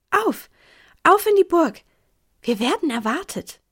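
high, loud call to set off; rally the troops with eager urgency; bright-eyed command, starting high and settling; excited rallying call. A narrator's reading. Clara rallies group after joyful letter.

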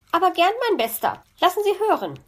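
high, quick, delighted acceptance; delighted magpie. A narrator's reading. Magpie eager to hear fox's song, delighted. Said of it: "freute sich die Elster".

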